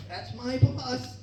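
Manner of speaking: put-on high, slow little-girl voice. Daughter delivering dad's line to his boss in a child voice. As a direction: drawl the punchline like a small child. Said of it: "and she goes".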